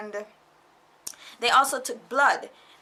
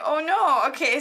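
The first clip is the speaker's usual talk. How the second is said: higher, louder flustered "oh no"; security guard reacting to a crying foreigner, flustered; alarmed guard; 5 semitones higher, 6 dB louder than usual. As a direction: mimic a startled security guard. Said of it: "And he's like".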